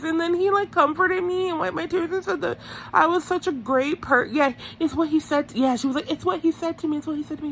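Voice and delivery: high, insistent mimicry; Teresa. Recapper mimicking a contestant bragging about being comforted, high insistence.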